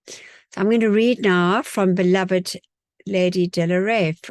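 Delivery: normal talking voice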